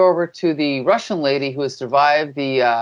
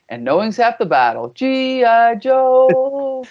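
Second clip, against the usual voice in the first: "G I Joe" stretched out very slowly and much higher; cartoon tagline announcer; 8 semitones higher, slower than usual. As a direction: boom the closing slogan like a kids' cartoon announcer. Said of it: "I feel like they should have had".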